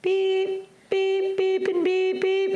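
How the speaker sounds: very high, long imitated bleeps; censor beeps. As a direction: mimic a censor bleeping out swearing. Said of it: "put the beeps on this".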